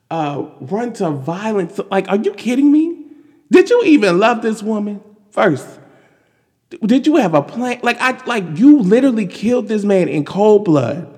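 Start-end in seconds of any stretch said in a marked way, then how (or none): 1.9-11.1 raised, higher, louder indignant disbelief; incredulous, heated rant; exasperated rant. Real, not acted. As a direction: let the outrage spill over. Ranting at the officer.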